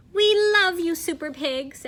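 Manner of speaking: high, loud cheering crowd; excited cheer. Acted. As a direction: cry it out like adoring fans. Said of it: "everyone cried".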